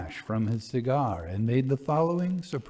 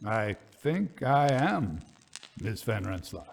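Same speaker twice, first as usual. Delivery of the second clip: deliberate; 4 semitones lower, 3 dB quieter than usual. Acted. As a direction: weigh every word. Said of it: "answered deliberately".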